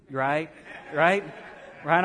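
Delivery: ordinary talk, nothing marked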